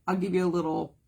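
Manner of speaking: ordinary talk, nothing marked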